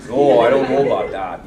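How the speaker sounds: loud, hammed-up Fargo-style Canadian accent; generic Canadian accent. Shown as a demonstration. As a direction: do a stock Canadian, laughing and loud. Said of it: "the generic Canadian accent".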